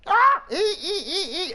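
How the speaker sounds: high, laughing squeal of a pig. Pretend. Friend imitating the victim squealing like a pig, high squeals.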